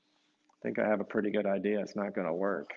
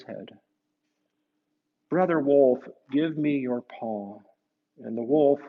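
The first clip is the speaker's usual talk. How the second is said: story narration; 3 semitones higher, 4 dB louder than usual. Acted. Reading aloud to children, lively storyteller. Reads picture book aloud.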